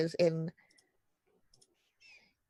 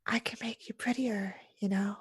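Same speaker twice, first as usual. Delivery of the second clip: hushed, higher creaky disused whisper; 3 semitones higher than usual. Acted. Veiled woman tempting the player to be prettier, whispering. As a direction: whisper as if unused to speaking.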